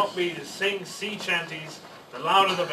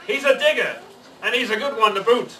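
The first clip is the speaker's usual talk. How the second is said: louder, higher pirate call with a laugh; pirate yell; 6 semitones higher, 6 dB louder than usual. Acted. Pirate captain praises the boy to his crew, loud. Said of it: "yelled back to his crew".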